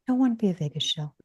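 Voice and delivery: fast, high, playful repeat; playful echo. Acted. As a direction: toss the line back, quickly.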